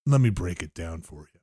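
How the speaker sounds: low, soft sales pitch; quiet salesman. Retold or imitated. Salesman insisting on explaining, low soft mock voice.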